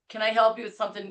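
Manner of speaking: ordinary talk, nothing marked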